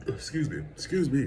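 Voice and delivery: talking right after burping. Real, not acted. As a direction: excuse me through a burp. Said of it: "I'm burping all on the videos".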